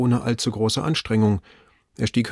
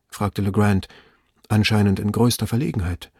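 plain reading voice

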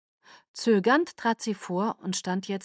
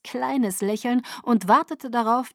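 ordinary delivery

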